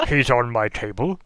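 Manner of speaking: loud, fussy complaint; peevish old mage pointing at the mess; indignant old wizard. Acted.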